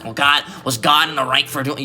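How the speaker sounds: mock indignant questioner, lightly voiced; mad forum poster. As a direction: imitate an offended complainer. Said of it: "they get mad".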